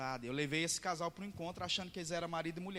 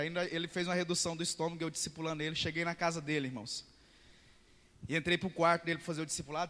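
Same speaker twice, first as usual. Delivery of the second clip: ordinary talk, nothing marked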